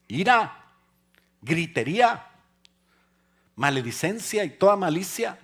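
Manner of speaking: high, loud preaching shout; shouting preacher. Real, not acted. Preacher shouting the verse at the congregation, very loud and high. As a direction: preach it at full force, shouting. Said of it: "Ya estoy gritando".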